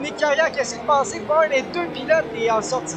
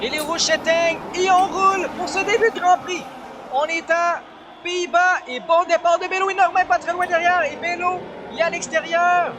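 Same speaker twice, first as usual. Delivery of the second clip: motorsport commentator; 5 semitones higher than usual. Real, not acted. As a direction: lively race play-by-play, pitched up. Live race play-by-play. (commentateur de course animé)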